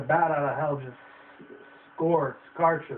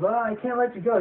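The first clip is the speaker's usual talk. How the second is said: a hint higher, mock firm; lightly raised refusal; 7 semitones higher than usual. Oz refusing to let the girl come, lightly voiced. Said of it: "James Franco's".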